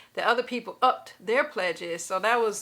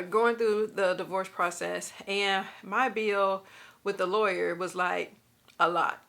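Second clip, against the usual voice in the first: ordinary delivery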